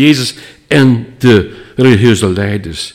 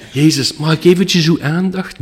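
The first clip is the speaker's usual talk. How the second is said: polite request; 7 semitones higher than usual. Shown as a demonstration. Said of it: "Nee, dat was echt roepen naar hem".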